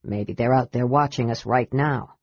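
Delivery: low dwarf voice; dwarf's voice. A narrator's reading. Dwarf warns his friend of watchers, in a low voice. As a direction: pitch it down for the dwarf.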